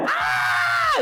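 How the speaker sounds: put-on high baby wail; baby cry. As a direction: let out a newborn's cry. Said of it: "you yelled".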